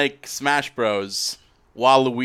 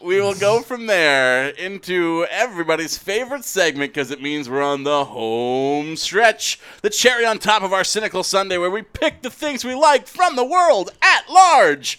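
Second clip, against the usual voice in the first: high, swooping show-host build to a loud climax; hammed-up segment announcer; 7 semitones higher, 4 dB louder than usual.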